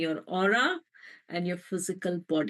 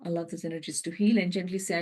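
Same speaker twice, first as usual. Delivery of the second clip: guided meditation. Acted. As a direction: let the words settle over them. Meditation guidance.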